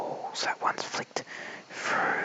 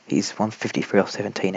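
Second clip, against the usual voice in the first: normal talking voice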